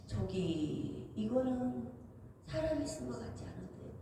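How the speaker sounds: hushed remark. Retold or imitated. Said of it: "이 책을 읽으면서 그래요".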